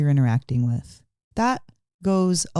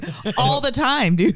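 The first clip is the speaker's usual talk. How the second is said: ordinary talk, nothing marked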